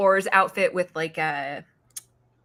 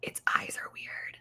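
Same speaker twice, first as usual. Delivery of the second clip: hushed whisper, slowed; daughter whispering; 11 dB quieter than usual. Retold or imitated. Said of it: "She's like, Mom".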